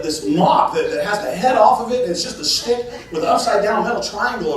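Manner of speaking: usual speaking voice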